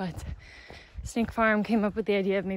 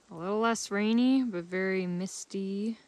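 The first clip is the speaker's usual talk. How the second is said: normal talking voice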